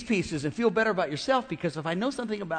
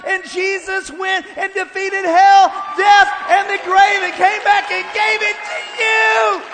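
higher, louder climactic preaching, slowing on the peaks; preaching at full force; 11 semitones higher, 10 dB louder than usual. Acted. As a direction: shout the promise to the congregation.

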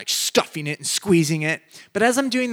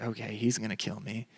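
soft, low, nervous inner thought; scared aside; 5 semitones lower, 11 dB quieter than usual. Retold or imitated. Preacher recalling fear as the husband approaches, a soft nervous aside. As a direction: mutter it as if he might hear you. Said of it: "I'm like".

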